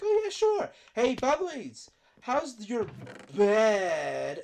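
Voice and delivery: high, loud, sing-song jab at a friend; cheeky high teasing voice; mock teasing friend. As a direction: play the smug friend needling him.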